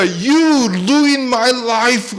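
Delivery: a hint of a bitter son, slowly; resentful teen in English. Teenage son blaming his father in English, bitter. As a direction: spit it out like a hurt teenager. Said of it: "이 영어로 얘기하는 거야".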